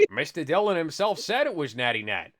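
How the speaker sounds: ordinary talk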